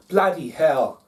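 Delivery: loud, high mock Kiwi exclamation; New Zealand Kiwi accent. Host demonstrating the accent he lacks, mock Kiwi accent. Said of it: "New Zealand Kiwi accent".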